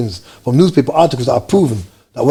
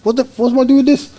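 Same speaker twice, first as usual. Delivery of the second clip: baffled; 11 semitones higher than usual. Retold or imitated. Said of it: "I was like".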